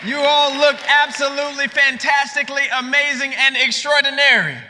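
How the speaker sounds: high, projected hype to the crowd; rally speech. Real, not acted. Speaker setting up a call and response, high rally voice.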